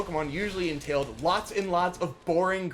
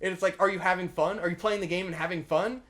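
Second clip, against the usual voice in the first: usual speaking voice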